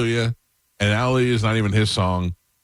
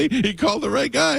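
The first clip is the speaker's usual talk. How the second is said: high-pitched, laughing through the words; real cracking-up, voice jumping way up. Real, not acted. Friend teasing caller about the delts story, laughing.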